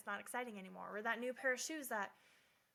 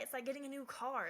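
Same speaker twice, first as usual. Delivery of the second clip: ordinary delivery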